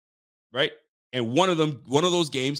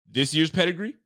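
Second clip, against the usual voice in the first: higher, questioning echo of his word; skeptical retort; 5 semitones higher than usual. Retold or imitated. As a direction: quote yourself challenging his answer. Questioning brother's answer in retold exchange.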